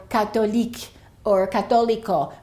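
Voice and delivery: 'Catholique' and 'Católico' with the o fully sounded; foreign pronunciations. Shown as a demonstration. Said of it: "the O is definitely pronounced".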